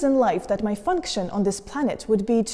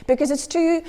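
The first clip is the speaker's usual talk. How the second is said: stammering, higher and building to a loud burst; overwhelmed outburst; 5 semitones higher, 4 dB louder than usual. Acted. Thinking aloud about having a child, overwhelmed. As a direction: get stuck on the words, then let it burst out.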